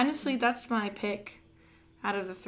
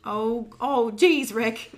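stammering, loud cartoon impression; fretful sidekick protesting, played for laughs; Morty; 6 dB louder, much slower than usual. Joking cartoon reaction to the show, loud stammering impression.

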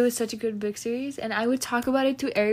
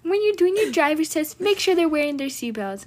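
higher-pitched imitation of test advice; mock advice-giver; 5 semitones higher than usual. Friend mimics driving-test tips heard online, mock advice-giving. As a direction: parrot the advice in a raised voice.

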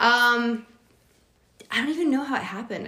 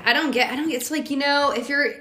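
ordinary delivery

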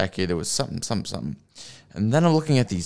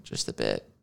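low muttered aside; 12 semitones lower, 9 dB quieter than usual. Pretend. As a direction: drop low and soft, under the breath. Repeats phrase in contrasting voices.

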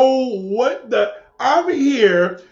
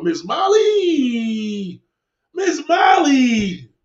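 calling out; 4 semitones higher, much slower than usual. Real, not acted. Calling out to a viewer.

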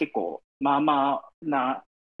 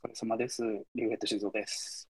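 speaking softly in a shared lobby; hushed voice; 3 semitones lower, 7 dB quieter than usual. Real, not acted. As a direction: keep it low so others won't hear. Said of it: "ひそひそ声で喋ってるけど。".

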